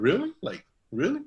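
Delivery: high, rising "really?"; pleased disbelief. Retold or imitated. Reacting to a relative's praise, high and surprised. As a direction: act stunned by the compliment.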